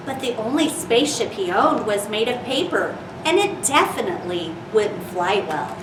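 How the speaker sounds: gentle storybook reading voice; reading to children. Acted. Reads picture book to children.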